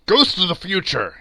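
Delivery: startled exclamation; exclaiming. A narrator's reading. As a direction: cry out to the phantom. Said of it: "he exclaimed".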